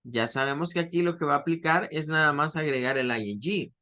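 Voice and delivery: ordinary talk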